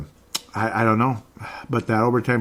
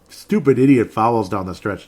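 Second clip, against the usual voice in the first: ordinary talk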